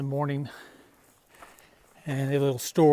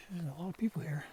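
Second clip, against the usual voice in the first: whispering; 11 dB quieter, faster than usual. Real, not acted. Whispering near other campers.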